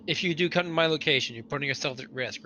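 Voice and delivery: ordinary talk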